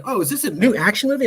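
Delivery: naive excitement. Retold or imitated. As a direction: play the excited moviegoer who has it wrong. Retells mistaking riot for movie.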